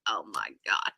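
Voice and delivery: breathless whispered oh my God; scandalized hush; shocked whisper. Real, not acted.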